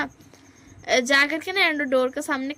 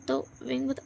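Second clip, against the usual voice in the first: ordinary delivery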